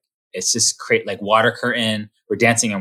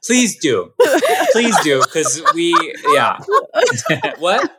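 laughing; 7 semitones higher, slower than usual. Real, not acted. Laughing at the joke.

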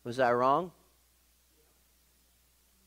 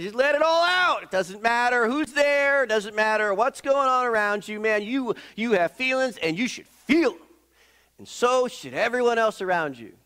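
mock let-it-all-out voice; 8 semitones higher than usual. Preacher mocking the younger generation, high and over the top. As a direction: parody someone who lets every feeling out.

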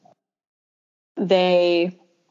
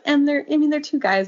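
ordinary talk

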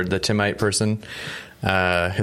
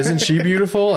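mock father-in-law pitch; 10 semitones higher, 4 dB louder than usual. Father-in-law offering younger daughter.

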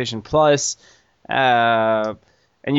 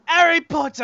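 very high, squawky mock British accent. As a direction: echo it in an exaggerated British accent. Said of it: "you don't need to do the accent".

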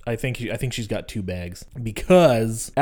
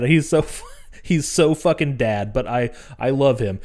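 normal talking voice

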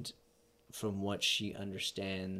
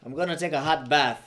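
high, loud Jamaican character voice; Jamaican accent; 5 semitones higher, 8 dB louder, faster than usual. Acted. Podcaster acts a sled team scene, heightened Jamaican accent. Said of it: "I gotta do an accent and a character".

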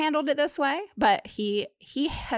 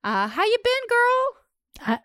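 much higher, put-on sassy drawl; sassy girlfriend voice; 14 semitones higher, slower than usual. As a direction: greet her like a gossiping bestie. Sassy greeting to friend.

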